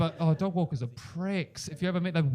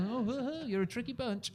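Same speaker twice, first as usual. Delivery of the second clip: mock scolding; 8 semitones higher, 7 dB quieter, slower than usual. Mock aside to audience.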